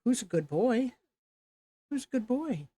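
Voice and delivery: high singsong pet voice; talking to a dog. Real, not acted. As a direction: coo praise at the dog.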